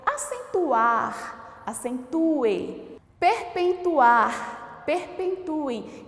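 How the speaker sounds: Acentuar, acentue, Perpetuar, perpetuem spoken very slowly; teacher dictating verb forms slowly; slow demo words. Shown as a demonstration. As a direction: drag out each form so the final E is clear. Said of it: "E eu escrevo com E no final".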